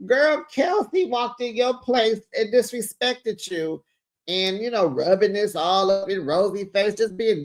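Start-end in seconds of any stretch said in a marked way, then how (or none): none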